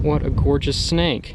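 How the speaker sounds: usual speaking voice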